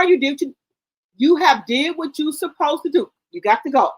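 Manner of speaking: ordinary delivery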